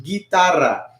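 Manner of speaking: Guitarra as "gee-TAR-ra", hard g; Spanish pronunciation. Shown as a demonstration. Teacher has everybody repeat, Spanish pronunciation. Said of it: "Everybody try that".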